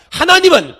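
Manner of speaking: loud lead line for the congregation; call to repeat. Shown as a demonstration. Pastor leading congregation, loud call to repeat. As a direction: shout it out for them to echo. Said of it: "따라합시다".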